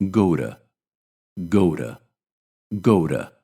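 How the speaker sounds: "go to" blended as "go ra", to with a flap and weak vowel; "go to" said as one unit, "to" as "ra"; relaxed, linked "go to"; reduced go to. Shown as a demonstration. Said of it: "when phrased naturally, sound like".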